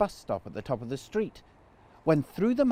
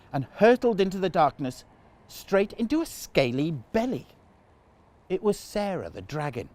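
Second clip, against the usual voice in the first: ordinary delivery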